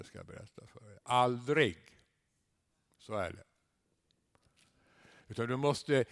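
ordinary talk, nothing marked

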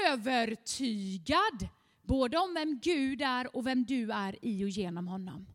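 ordinary delivery

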